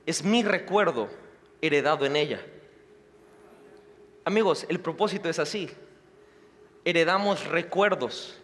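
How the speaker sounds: ordinary talk, nothing marked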